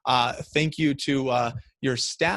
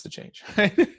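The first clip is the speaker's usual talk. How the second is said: laughing; 8 semitones higher, 3 dB louder than usual. Real, not acted. Laughs at own point.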